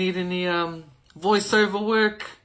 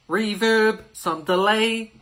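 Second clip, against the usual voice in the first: ordinary talk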